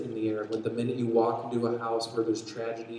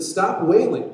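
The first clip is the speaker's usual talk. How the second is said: loud, raised-pitch order; firm command; 7 semitones higher, 9 dB louder, slower than usual. A narrator's reading. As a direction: call it out over wailing mourners. Reading Jesus's command to mourners.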